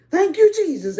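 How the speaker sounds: high, loud burst of thanks; praise shout. Real, not acted. Preacher bursts out praising Jesus mid sermon. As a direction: cry out to Jesus.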